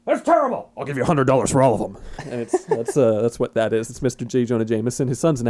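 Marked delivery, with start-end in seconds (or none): 0.1-2.0 barking newspaper editor. Retold or imitated. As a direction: dismiss the pictures like a gruff boss. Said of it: "and he's like".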